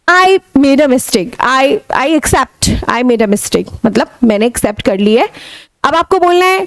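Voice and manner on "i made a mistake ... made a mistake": slow, coaxing apology; slow apology to friend. Shown as a demonstration. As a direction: win a friend back, slowly. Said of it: "English बोलो तो काम चल जाए".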